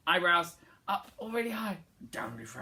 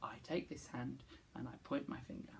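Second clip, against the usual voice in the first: softer, lower echo; quiet; 4 semitones lower, 15 dB quieter than usual. Shown as a demonstration. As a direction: say it back quietly. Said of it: "You say it".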